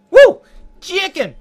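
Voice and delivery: loud, high Brooklyn accent yelp. Shown as a demonstration. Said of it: "let me try my Brooklyn accent".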